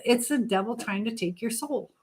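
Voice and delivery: normal talking voice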